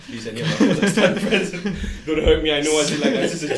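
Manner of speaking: laughing while begging; chuckling mock plea to be spared; laughing apology. Real, not acted. Podcast host laughing as he begs Kazakh friends not to hurt him.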